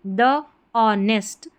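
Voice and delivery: slowly, द honest drawn out; slow model reading. Shown as a demonstration. Teacher reading the title slowly for learners to repeat. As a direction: read द honest slowly, word by word. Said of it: "ओ और N E S T".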